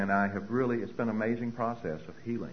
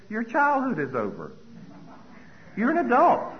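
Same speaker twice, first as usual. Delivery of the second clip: higher and louder, a firm declaration; firm woman at meeting; 13 semitones higher, 7 dB louder than usual. Retold or imitated. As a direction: hand down the news like a verdict.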